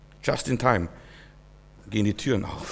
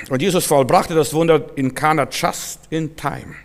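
ordinary delivery

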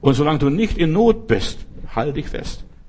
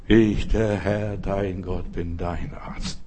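solemn voice of God; 6 semitones lower, 3 dB quieter, much slower than usual. Acted. Voicing God's word.

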